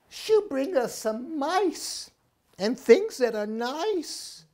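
big sister owl calling for Mommy; worried big sister owl. Acted. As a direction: ask for Mommy in a fretful high voice. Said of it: "said Sarah".

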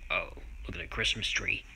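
normal talking voice

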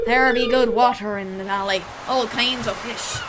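put-on old pirate giving a fishing tip; soft-trailing pirate fisherman voice; pirate accent. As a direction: talk like a salty pirate. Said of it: "give him a pirate accent now".

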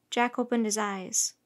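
ordinary delivery, nothing marked

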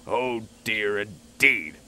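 a stern authority figure. A narrator's reading. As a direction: disapprove firmly, stressing the end. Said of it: "said a stern voice".

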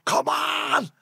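high, drawn-out urging cry; retold shouting. Urging his dying mate back, high retold shout. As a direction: call out urgently, pitched right up. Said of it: "I'm shouting".

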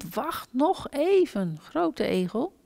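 slow, high little hedgehog voice; drawn-out plea from a small hedgehog; little hedgehog calling. A narrator's reading. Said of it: "plotseling riep".

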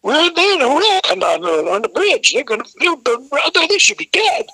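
high, frantic panic; shrill-free panicked high chatter, climbing; panicked staff. Retold or imitated. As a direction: squeal it like scared staff. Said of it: "and they go".

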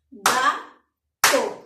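Gato split as ga-to, loud, a clap on each syllable; clapped syllables. Shown as a demonstration. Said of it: "¿Cuántas palmadas he dado?". (Gato silabeado fuerte, con palmadas)

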